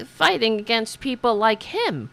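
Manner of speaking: normal talking voice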